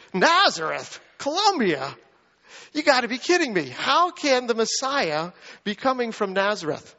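mock the very idea, sarcastically; cynical sarcasm. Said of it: "cynical, sarcastic".

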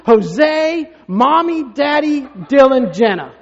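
put-on high, slow, shouted child listing his ranking; kid shouting list. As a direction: yell out the order of importance like an outraged five-year-old. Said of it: "He's like".

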